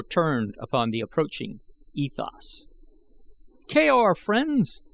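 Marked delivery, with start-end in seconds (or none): 3.7-4.8 much higher, louder cheerful greeting; exclaimed greeting. A narrator's reading. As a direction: call out a hearty hello to the group. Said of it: "he exclaimed".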